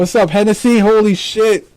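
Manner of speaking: loud, high, thrilled greeting; excited shout-out. Real, not acted. Host hollers a greeting to a viewer in the live chat, loud and thrilled.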